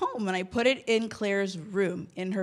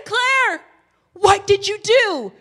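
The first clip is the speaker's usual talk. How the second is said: high, loud cry of alarm at her daughter; retold shocked shout; 8 semitones higher, 9 dB louder, slower than usual. Mother finding her child's cut hair, shouting in shock. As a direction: mom yelling in horror, high and loud. Mom discovers daughter's haircut.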